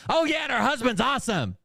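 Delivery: high, bright mock enthusiasm; imitating Mariah overeager; eager agreement. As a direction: play the girlfriend jumping at the idea. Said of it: "and she's like".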